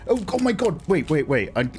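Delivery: ordinary delivery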